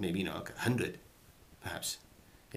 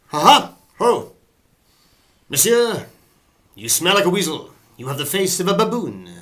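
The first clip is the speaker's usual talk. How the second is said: loud, high swashbuckling English delivery; ringing stage taunt with hearty ha-has; musketeer without accent; 9 semitones higher, 13 dB louder than usual. Acted. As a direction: hurl the insult like a musketeer, no French accent. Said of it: "you don't need the French accent there".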